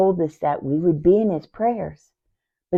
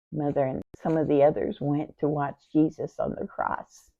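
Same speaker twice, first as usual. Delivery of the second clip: ordinary talk, nothing marked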